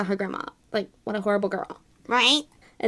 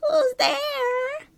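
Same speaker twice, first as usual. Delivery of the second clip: grandma voice. Acted. Grandma voice in read story.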